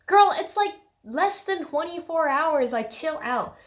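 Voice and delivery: put-on exasperated girlfriend-to-girlfriend telling-off; mock exasperation. Scolding Bella in mock exasperation.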